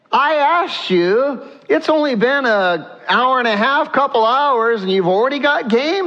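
a father who doesn't buy the story; suspicious old Isaac. Acted. Isaac suspicious, questioning his son.

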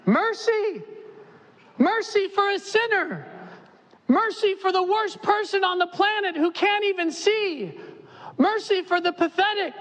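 high desperate pleading cry; crying out for mercy. Acted. Blind men crying out to Jesus, desperate high plea. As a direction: beg at the top of the lungs. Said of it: "It's shouted at the top of their lungs".

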